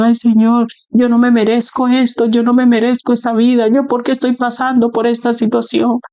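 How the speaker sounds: high, whiny self-pitying plea; mock crying lament. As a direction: whimper it like a believer sorry for himself. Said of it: "usted está llorando".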